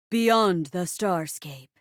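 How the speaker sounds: low, slow, grand announcement; dramatic title reveal. Acted. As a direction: intone the sci-fi title low and drawn out. Host announces sci-fi play title.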